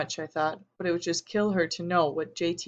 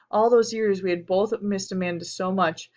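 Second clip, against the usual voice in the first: ordinary delivery, nothing marked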